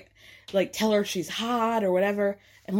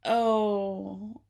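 long drawn-out mock "oh"; stretched, put-on reaction noise; awkward group reaction; 2 semitones lower than usual. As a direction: imitate the table's uneasy oh. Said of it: "everybody's just sitting there like".

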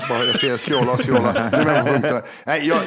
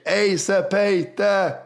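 mock heckle shout; 6 semitones higher than usual. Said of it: "en som skrek".

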